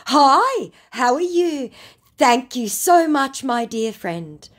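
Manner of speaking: a wounded, wheedling scammer; mock scammer voice. Scammer Eric's chat read out.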